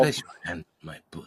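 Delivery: very low, soft mock Spanish accent; Antonio Banderas. Spanish actor coming on to friend, low Spanish accent. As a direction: seduce in a deep Spanish accent. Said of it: "Antonio Banderas".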